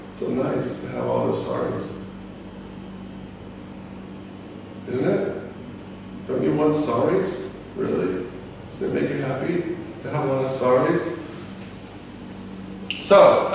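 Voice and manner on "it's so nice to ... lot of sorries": soft, deadpan sarcasm; sarcastic. Acted. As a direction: drop the volume and feign contentment. Sarcastic praise of useless apologies.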